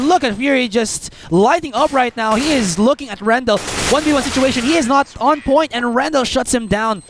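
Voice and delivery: high, hyped clutch call; esports caster. Real, not acted. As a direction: shout the clutch as it happens. Clutch moment called live.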